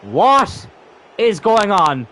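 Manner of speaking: high, loud, rising cry; incredulous. Real, not acted. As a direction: burst out in real disbelief. Disbelief at missed chance.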